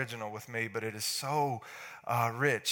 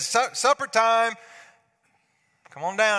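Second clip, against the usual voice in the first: loud, higher call to supper; 7 semitones higher, 8 dB louder than usual. Retold or imitated. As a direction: call them down from upstairs. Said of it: "I said".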